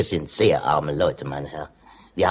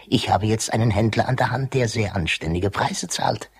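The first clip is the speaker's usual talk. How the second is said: ordinary talk, nothing marked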